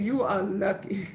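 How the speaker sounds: low, quiet threat to the man who called her out; quiet warning. Retold or imitated. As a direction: let him know he got off lucky.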